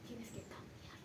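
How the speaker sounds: breathy whisper, almost no voice; whispered urging. Retold or imitated. Inner voice telling Jacob to change, whispered. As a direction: breathe it like a voice inside his head.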